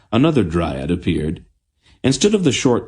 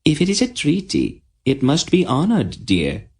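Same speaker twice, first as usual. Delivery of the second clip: gentle queen; 9 semitones higher than usual. A narrator's reading. The queen gently reminding Tenedra of the treaty. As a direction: soothe the girl kindly. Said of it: "the queen said gently".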